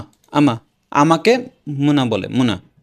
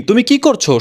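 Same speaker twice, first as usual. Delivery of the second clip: usual speaking voice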